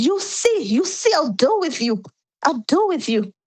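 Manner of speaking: high, mock-menacing mother's voice; mother-in-law's threat. Recounting the mother-in-law's threat, high and mock-menacing.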